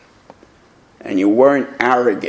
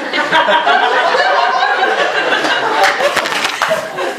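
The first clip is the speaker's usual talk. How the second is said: breathy burst of real laughter; a real high cackle; high unvoiced laugh. Real, not acted. Long laugh after punchline.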